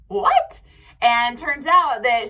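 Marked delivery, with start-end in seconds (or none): 0.1-0.6 a sudden high, loud "what?"; jolted disbelief, pitch jumping up; shocked. Retold or imitated. Recognized at the park by a listener, high astonished yelp. Stranger recognizes her podcast voice.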